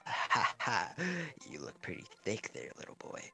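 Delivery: breathy, soft creepy taunt; creepy whisper. Retold or imitated. Orochimaru taunting Naruto, hushed creepy whisper. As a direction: hush it like a creep. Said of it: "As he goes".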